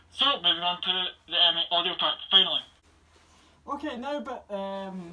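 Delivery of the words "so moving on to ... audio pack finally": louder, through a mask voice amplifier. Real, not acted. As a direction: speak through a small loudspeaker. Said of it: "sorry about the feedback".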